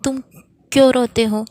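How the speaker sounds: put-on a teacher-queen scolding a crying child. A narrator's reading. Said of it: "रात रानी डांट कर कहती है".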